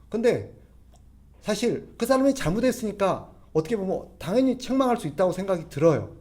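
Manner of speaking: ordinary talk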